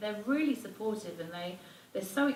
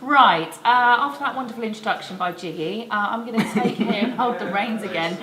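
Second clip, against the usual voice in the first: much louder and higher host voice; loud presenter voice; 4 semitones higher, 12 dB louder than usual. Real, not acted. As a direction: take back the reins loudly. Hosts loudly over laughter.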